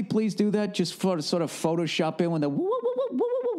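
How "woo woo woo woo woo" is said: high rapid "woo woo" whooping; cartoonish falsetto whoops; Curly. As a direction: whoop like a slapstick stooge. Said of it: "Curly from the fucking Three Stooges".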